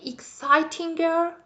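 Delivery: put-on exciting girl asked as a high, rising question; wrong form. Shown as a demonstration. Teacher testing the class with the wrong comparative. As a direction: float exciting girl as a tempting mistake. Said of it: "no, don't say it".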